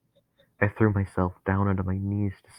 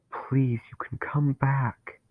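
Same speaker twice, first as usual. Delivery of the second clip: slow, breathy begging whisper; pleading whisper; 6 semitones higher, slower than usual. A narrator's reading. Narrator begging Dennis to come back, whispered.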